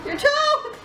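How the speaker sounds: high squeal of mock shock; playful surprise. Real, not acted. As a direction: act shocked at the toe.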